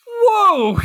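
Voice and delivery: high, loud startled whoa; amazed. Retold or imitated. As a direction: gasp it out, way up high. Viewer reacts to explosion.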